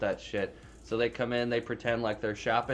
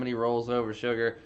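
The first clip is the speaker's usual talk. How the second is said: ordinary talk, nothing marked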